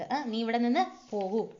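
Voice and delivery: usual speaking voice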